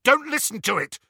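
high, urgent, harsh command. A narrator's reading. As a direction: cut in sharply with a warning. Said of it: "Harry said harshly".

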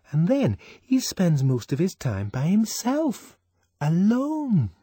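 high, horrified gossip. A narrator's reading. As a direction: sound appalled at "alone". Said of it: "There was horror in Fanny's voice".

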